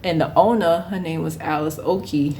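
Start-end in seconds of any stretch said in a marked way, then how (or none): none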